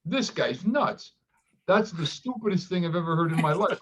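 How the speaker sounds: retelling his younger self scoffing at the doctor; incredulous. Retold or imitated. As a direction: scoff at the idea, half acted out. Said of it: "And I'm like".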